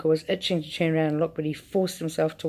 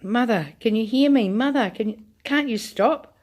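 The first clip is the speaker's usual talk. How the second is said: wailing; 5 semitones higher than usual. A narrator's reading. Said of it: "wailed Missus Bucket".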